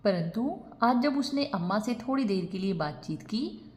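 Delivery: ordinary delivery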